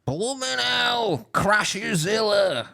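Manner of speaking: loud, high, drawn-out Cockney; hammed-up Cockney police-show commentary; Cockney voiceover. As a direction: bellow it slowly like a Cockney narrator. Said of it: "I don't think it works in a Cockney voice, the police voiceover".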